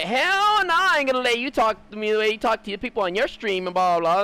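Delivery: squealed high sassy "hell no"; a girl snapping at a streamer, done as a high mock sass. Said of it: "Or like".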